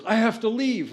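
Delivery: high, loud hammed-up exit; overdramatic. Jack telling the band he must leave, hammed-up and high. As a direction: announce leaving with fake urgency. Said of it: "he's being overdramatic".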